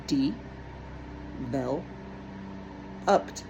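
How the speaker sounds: each syllable said apart with pauses; split into syllables. Shown as a demonstration. Teacher to students, sounding out syllables slowly. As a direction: sound out de, vel, upped one beat at a time.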